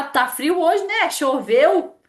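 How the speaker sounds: high, loud chirpy weather chat; forced small talk. Retold or imitated. The warm person breaking the elevator silence, chirpy small talk. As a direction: chirp brightly to fill the silence.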